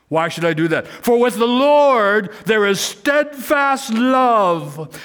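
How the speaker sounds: loud, high, very slow proclamation; full-force preaching. Acted. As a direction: stretch every word and call the people to hope.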